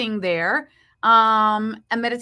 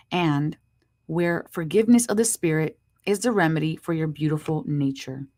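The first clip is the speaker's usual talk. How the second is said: inspirational reading; 6 semitones lower than usual. Acted. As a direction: read it like a soothing blessing. Reads the card's inspirational message.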